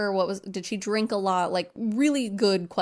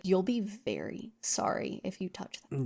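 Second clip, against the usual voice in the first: mock quiet threat; 7 dB quieter than usual. Voicing a menacing threat.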